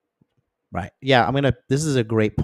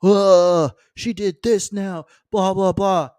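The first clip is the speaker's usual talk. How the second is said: high, slow, drawn-out mocking rant with a loud ugh; exasperated ranting boyfriend; 9 semitones higher, 6 dB louder, slower than usual. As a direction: whine like he's fed up again.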